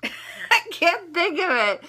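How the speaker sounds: laughing through the words, slow; real laugh, high and slow. Real, not acted. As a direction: giggle it out, high and loud. Laughing at forgetting a word.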